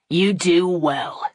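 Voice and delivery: slow, drawn-out contempt; slow bitter sarcasm. Acted. Thornton scorning the crowd, slowly and bitterly. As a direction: let each word land slowly and coldly.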